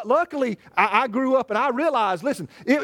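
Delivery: ordinary talk, nothing marked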